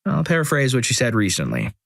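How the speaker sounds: ordinary delivery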